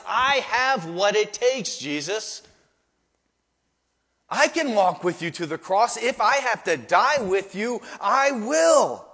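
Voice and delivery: loud, lifted, boastful vow; dripping self-confidence. Acted. Peter promising to follow Jesus to the cross, brimming with self-confidence. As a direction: swear it with total self-belief. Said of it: "They're dripping with self-confidence".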